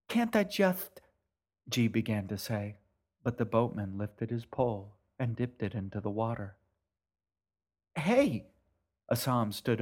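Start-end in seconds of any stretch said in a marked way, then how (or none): 0.1-1.0 pleading. Acted. Girl pleading, cut off. 7.9-8.5 sharp shout to stop him. Acted. Boy shouting at the boatman to stop.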